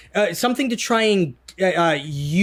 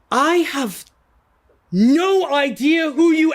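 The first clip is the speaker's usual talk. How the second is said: raised, slowed, bewildered NPC line; confused mage brushing off a stranger claiming kinship; 8 semitones higher, 3 dB louder, slower than usual. Acted. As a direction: play the confused mage, higher and drawn out. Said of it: "and he says".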